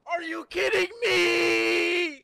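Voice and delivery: crying furious shout; raging gamer. Real, not acted. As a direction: scream it, voice cracking. Streamer raging at game.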